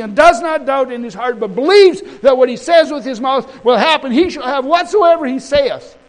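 high, loud, driving sermon delivery; full-force preaching. Real, not acted. Preacher quoting scripture to the congregation, full-force preaching.

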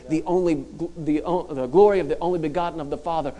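ordinary talk

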